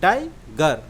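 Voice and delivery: "टाइगर" in two parts, टाइ then गर; split into syllables. Shown as a demonstration. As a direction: say "टाइगर" with a break before गर. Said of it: "दूसरा शब्द है गर". ("टाइगर" दो हिस्सों में: टाइ, गर)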